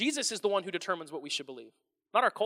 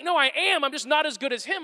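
put-on higher, louder, mildly exasperated kid; frustrated son; 6 semitones higher, 6 dB louder than usual. Son correcting his dad in the car, mildly exasperated. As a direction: push back, a little fed up. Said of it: "he's getting frustrated with me".

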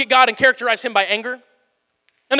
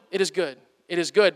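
lower, softer, dutiful drone; a hint of a joyless solemn God; somber God voice; 6 semitones lower, 5 dB quieter than usual. Preacher mocks a dull God reading, lightly somber. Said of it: "we think God is just going".

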